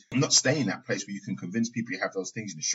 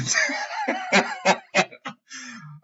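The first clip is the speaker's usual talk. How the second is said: a high unvoiced laugh breaking the quote; breathy laugh; 9 semitones higher, 4 dB louder than usual. Real, not acted. Laughing while mimicking the girl giving in. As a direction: laugh through the punchline.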